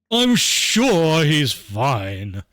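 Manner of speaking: very slow, loud, stretched-out reassurance; dismissive drawl. Acted. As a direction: brush off the worry, dragging every word. Mary brushes off the worry.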